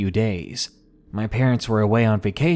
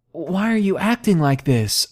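higher, distressed cry without volume; understated scream; 7 semitones higher than usual. A narrator's reading. Crying girl to her attacking boyfriend, mildly screaming. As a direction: raise the pitch in a restrained scream. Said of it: "I screamed".

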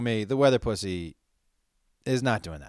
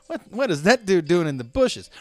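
startled; 6 semitones higher, 7 dB louder than usual. Retold or imitated. Startled bystanders voiced.

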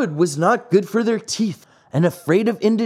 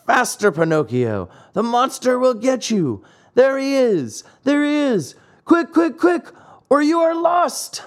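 urgent warning call; 8 semitones higher than usual. Acted. Goat on the rock urges Pinocchio to swim, frantic shouting. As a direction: warn him the monster is coming.

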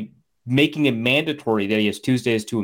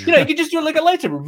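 mock nerdy coach voice; 13 semitones higher, much faster than usual. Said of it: "and he's like".

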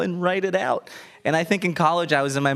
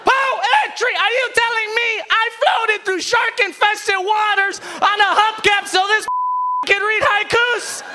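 high, loud outraged immigrant dad; 4 dB louder than usual. Retold or imitated. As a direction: shout it like an indignant Cuban father. Dad's outraged rant, voiced.

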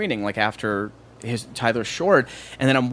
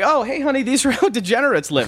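put-on high-pitched baby-talk to a daughter; playful sing-song parent voice, pitched way up; Adam Sandler; 10 semitones higher than usual. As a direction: coo it like a joking dad.

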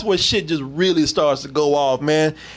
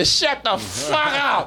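slow mock retort; 8 semitones higher, much slower than usual. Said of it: "You can't say".

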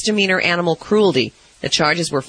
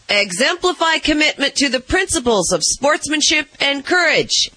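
quoted award wording; 8 semitones higher than usual. Retold or imitated.

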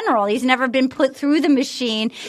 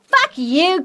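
ordinary talk, nothing marked

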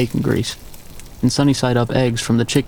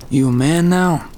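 a touch of a sneer, higher; mock the boy lightly; understated scornful old man; mild sneer; 4 semitones higher than usual. A narrator's reading. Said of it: "The sneer was still there".

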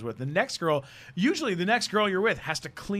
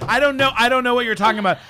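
raised, high-pitched irritation; irritated retort; 8 semitones higher, 8 dB louder, faster than usual. Retold or imitated. Girlfriend denying she knows the deli, irritated. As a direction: fire back loud and high, acting annoyed. Retold girlfriend arguing back.

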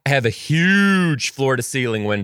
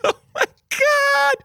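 high, drawn-out squeal of delight; excited squeal; slower than usual. Retold or imitated. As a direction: gush as if overwhelmed by the gift. Thanked for gift by child.

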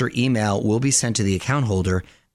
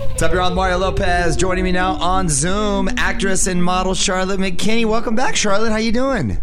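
radio host voice; 7 semitones higher, 5 dB louder than usual. Acted. Hypes the guest intro.

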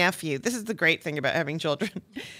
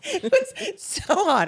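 much higher, slow laughing cry; laughing squeal; much slower than usual. Retold or imitated. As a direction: squeal through laughter at the heat. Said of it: "And I was like".

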